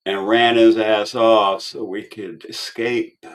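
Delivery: ordinary talk, nothing marked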